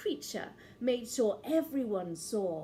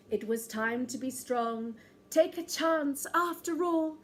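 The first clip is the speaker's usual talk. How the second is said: ordinary delivery, nothing marked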